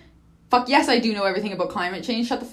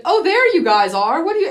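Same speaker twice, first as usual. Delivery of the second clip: husband arriving to find the guests, loud and cheery; cheery husband mimic; 7 semitones higher, 8 dB louder than usual. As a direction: play the husband walking in happily. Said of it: "he's like".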